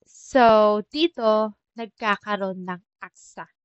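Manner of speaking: ordinary delivery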